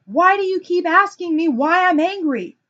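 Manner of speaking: high, loud exasperated yell; angry screaming. Retold or imitated. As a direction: shout it back like an irate husband. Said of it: "screaming still, and say".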